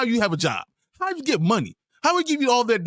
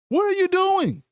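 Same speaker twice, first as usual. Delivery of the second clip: exasperated yell; 13 semitones higher than usual. Real, not acted. Outburst at a bad player.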